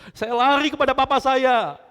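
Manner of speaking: loud, high, urgent preaching; loud climax. Real, not acted. Preacher hits the climax of the testimony, loud and high.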